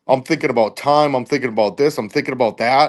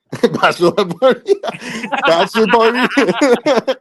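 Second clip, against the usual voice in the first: laughing through it; 8 semitones higher than usual. Real, not acted. Laughing over own slip.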